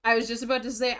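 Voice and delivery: ordinary talk, nothing marked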